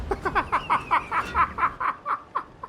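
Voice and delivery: high-pitched long giggle. Real, not acted. Commentator cracking up at his own tank voice, high giggle. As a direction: giggle high after the joke. Laughing after the voice.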